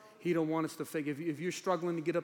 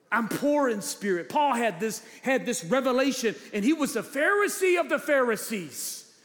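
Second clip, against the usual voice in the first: emphatic, raised preacher's voice; forceful preaching; 7 semitones higher, 6 dB louder than usual. Real, not acted. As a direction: drive each promise home to the congregation.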